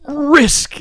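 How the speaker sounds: high, hammed-up captain's outburst; William Shatner. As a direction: punch it out high like a theatrical captain. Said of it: "such a Shatner moment".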